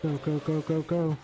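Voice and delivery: high panicked urging; being chased. Real, not acted. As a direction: hurry yourself along, scared. Fleeing a monster in game.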